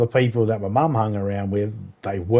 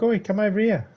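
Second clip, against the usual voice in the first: lightly voiced, higher-pitched neighbour; neighbour calling over; 9 semitones higher, 3 dB quieter than usual. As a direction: imitate a neighbour summoning someone over the fence. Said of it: "my neighbour pops his head over".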